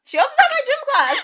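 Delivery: high, loud, amused squeal; excited squeal. Real, not acted. Friend recalls the gym teacher, squealing with amusement. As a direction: squeal it out in delight.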